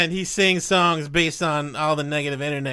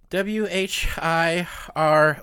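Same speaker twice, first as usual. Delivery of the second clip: slow letter-by-letter spelling; much slower than usual. Shown as a demonstration. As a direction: spell each letter out slowly. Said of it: "The word is whirligig".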